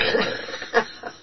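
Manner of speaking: short breathy chuckle; a brief laugh to oneself. Real, not acted. Speaker amused after telling of the demon cast out, chuckling.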